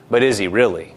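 lightly voiced doubt, pitched low; a low, understated nudge of doubt; subtle doubting voice. Inner pressure questioning God's willingness, subtly doubting. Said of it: "there's a subtle thing that's put".

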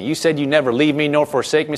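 ordinary delivery

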